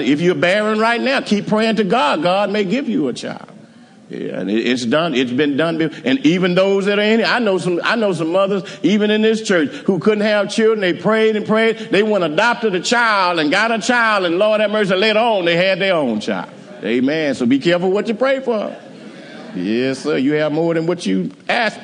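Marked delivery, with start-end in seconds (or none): 0.0-3.5 rising exhortation. Real, not acted. Preacher exhorting the congregation. 5.8-18.7 preaching at full force. Real, not acted.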